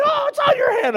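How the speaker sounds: high-pitched mock shriek; alarmed wife. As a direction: imitate a panicking wife squealing in alarm. Wife alarmed by spider on hand.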